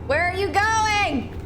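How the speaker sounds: high, drawn-out call; calling out, exasperated. Real, not acted. Streamer calls after the fleeing game creature, exasperated call.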